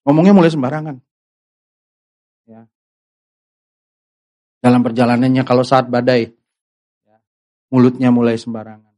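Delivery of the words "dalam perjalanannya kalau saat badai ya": ordinary talk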